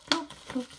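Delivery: "Ploup, ploup" popped out loud and playful; loud bubble-wrap pops. Pretend. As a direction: pop each ploup like bursting bubble wrap. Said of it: "j'adore le papier bulle". (des "ploup, ploup" lancés fort et joueurs)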